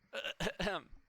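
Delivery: pointed, exaggerated ahem; throat clearing.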